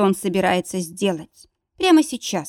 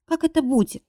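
whispering through tears; 9 semitones higher than usual. A narrator's reading. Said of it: "прошептала сквозь слёзы".

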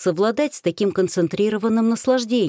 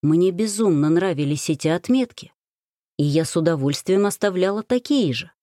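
ordinary delivery, nothing marked

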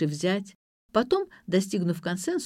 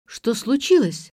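loud, high, drawn-out question; startled exclamation; 4 semitones higher, 6 dB louder, slower than usual. A narrator's reading. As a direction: exclaim it in alarm. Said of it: "воскликнула я".

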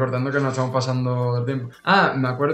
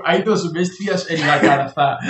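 laughing; slower than usual. Retold or imitated. Repeating the quote laughing.

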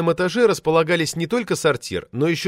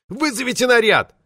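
much higher indignant shout; indignant demand; 10 semitones higher, 3 dB louder than usual. A narrator's reading. Offended administrator demands that the police be called.

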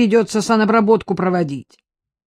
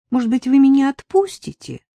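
higher, pleading little voice; plaintive; 8 semitones higher, 4 dB louder than usual. Acted. Nadezhda pleading with the sergeant, plaintive. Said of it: "жалобно проговорила Надежда Николаевна".

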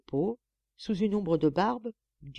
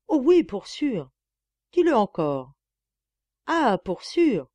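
ardent; 4 semitones higher, 8 dB louder, slower than usual. Acted. Boy and Eugénie, ardent.

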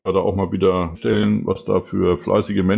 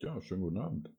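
faint and distant, far from the mic; barely audible greeting from afar; very quiet; 17 dB quieter, faster than usual. Real, not acted. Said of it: "[name], du bist so leise".

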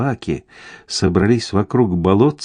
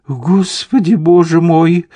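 high-pitched flustered exclamation; gasped-out appeal to God in mild panic; alarmed babble; 8 semitones higher, 4 dB louder than usual. A narrator's reading. Lady Cameron seeing riders gallop at them, alarmed babble. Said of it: "пролепетала леди Кэмерон".

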